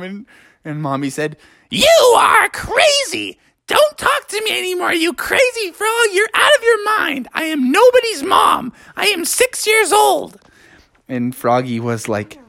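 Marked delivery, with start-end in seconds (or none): 1.7-10.4 put-on very high, outraged frog-girl squawk; crazy old-person voice. A narrator's reading. Girl frog tells Froggy off, crazy old-person voice. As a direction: rant in a silly screechy high voice. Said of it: "you still talk in that s-, crazy voice".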